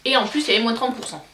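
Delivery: ordinary delivery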